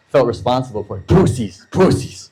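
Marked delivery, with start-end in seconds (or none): 1.1-2.3 put-on Brazilian accent. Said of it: "He loved to say".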